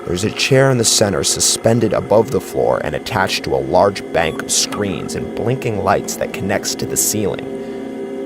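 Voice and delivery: plain reading voice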